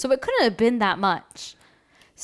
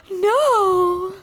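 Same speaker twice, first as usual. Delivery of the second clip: mock devastated; 10 semitones higher than usual. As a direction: wail it in fake despair. Reacts to Melissa joke.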